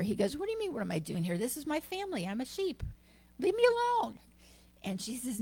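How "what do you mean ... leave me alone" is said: rushed, then a very high leave me alone; defensive kid brushing her off; indignant cub. Acted. Cub insisting to the lioness he is a sheep, high and indignant.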